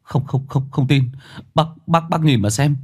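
high-pitched, stammering protest; agitated young man defending himself; flustered self-justifying. Acted. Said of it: "thanh niên tò mò ban nãy đã thanh minh".

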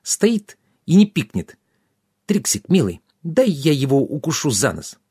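high fairy voice, feisty then wheedling; indignant fairy. A narrator's reading. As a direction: start high and scornful, then coax. Indignant fairy asks to bite him.

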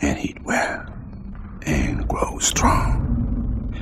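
slow breathy whispered recitation; hushed poetry reading over music; whispered poem. Acted.

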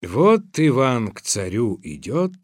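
ordinary delivery

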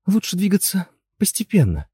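high, careful wifely suggestion; cautious high-pitched persuasion; placating. A narrator's reading. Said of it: "но вовремя спохватилась".

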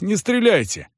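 high breathless plea; out of breath. Acted. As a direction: gasp out the plea. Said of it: "всё ещё задыхаясь". (задыхаясь)

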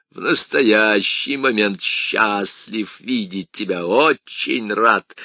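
slow, loud sneering demon voice; mocking demon. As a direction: drawl it out like a taunting devil. Demon mocks priest.

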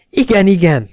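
high, loud, eager agreement; eager yes. A narrator's reading. Eugene agreeing with his wife, excited. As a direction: jump at it.

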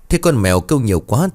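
usual speaking voice

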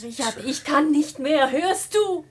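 hoch und laut, am Ende; flehend, hoch gerufen; verzweifelter Aufschrei. Acted. Frau bricht verzweifelt zusammen. (verzweifelter, lauter Ruf der Frau an Richard)

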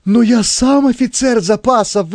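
high, desperate plea; panicked protest. A narrator's reading. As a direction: object in a high, rattled voice. Panicked manager protests to policeman.

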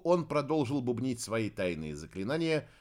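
ordinary delivery, nothing marked

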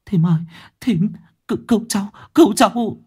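high, fast tearful pleading; girl sobbing to the neighbour woman for help; bursting into tears. Acted. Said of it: "òa khóc".

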